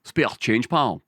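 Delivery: demonstrating a Glaswegian beggar's line; short Glaswegian catchphrase, vowels squeezed; Glaswegian accent. Shown as a demonstration. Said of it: "lock you into the Glaswegian accent".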